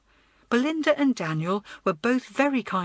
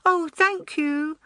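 put-on high, slow, grateful child; little boy's drawn-out thanks; grateful small boy; 10 semitones higher, 4 dB louder, slower than usual. A narrator's reading. Small boy thanks older girl for help, grateful. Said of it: "looked pleased and grateful".

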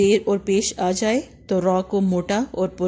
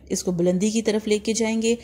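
ordinary delivery

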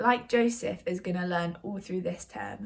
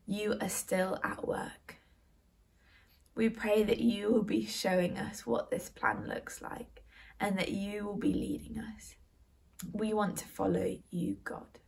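prayer. Acted. Leads a children's prayer.

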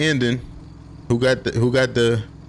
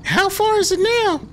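high friend voice. Acted. Voicing friend character.

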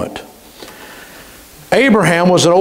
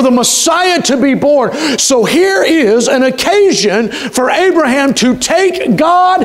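rising, fervent preacher's cry, much higher; fervent preaching; 10 semitones higher than usual. Acted. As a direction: preach it up high with full conviction. Preaching builds to high fervour.

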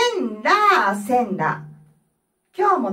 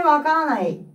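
usual speaking voice

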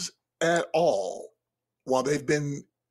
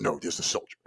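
lower, soft gruff one-liner; gruff actor line; 8 semitones lower, 4 dB quieter, faster than usual. Shown as a demonstration. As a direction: deliver it like a tough movie actor. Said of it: "who I'd wanna say that".

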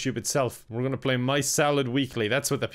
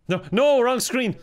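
high, louder flustered correction; flustered alarm; 8 semitones higher, 4 dB louder than usual. Real, not acted. Player on the wrong screen mid-broadcast, flustered alarm. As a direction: catch your own mistake in a panic.

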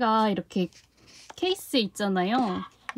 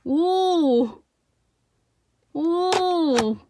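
high, drawn-out oohs of wonder; impressed ooh; 7 semitones higher, 4 dB louder, much slower than usual. Real, not acted. Host watches the container open, amazed. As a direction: let out long, high oohs.

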